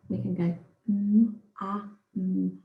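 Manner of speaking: mum sounded out as separate phonemes mm, ah, mm; sounding out words. Shown as a demonstration. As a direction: stretch each sound of mum apart. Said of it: "we can sound out mum".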